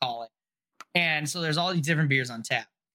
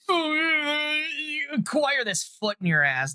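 high, slow, hammed-up mock threat; playful fake menace at a friend; 10 semitones higher, slower than usual. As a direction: drag it out like a cartoon tough guy. Mock threat at friend's beer advice.

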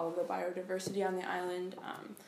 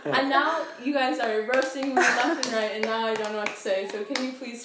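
laughing protest; 3 semitones higher, 11 dB louder than usual. Real, not acted. Flustered speaker protests being teased.

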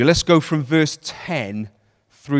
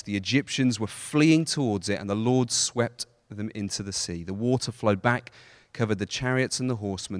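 scripture reading; 4 semitones lower than usual. Acted. Reads climax of passage.

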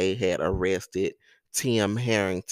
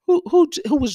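voice jumps up; 8 dB louder, much faster than usual. Real, not acted. Voice jumps while stammering.

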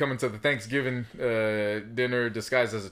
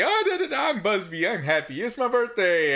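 sing-song, higher giddy imitation of his friend; mock happy host; 9 semitones higher than usual. Cohost mocking host's birthday cheer, giddy sing-song. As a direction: tease him with a bouncy birthday voice. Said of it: "You, you go all happy".

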